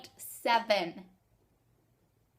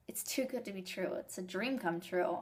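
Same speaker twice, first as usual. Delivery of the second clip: whispering; 3 semitones lower, 5 dB quieter than usual. Real, not acted. Awed, disbelieving aside.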